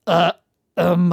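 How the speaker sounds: loud choked bursts, breaking off like radio interference; voice cutting out. Retold or imitated. Mocking how RFK struggles to get a word out, voice cutting out. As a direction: force out syllables that keep dropping out. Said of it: "like, interference in a radio".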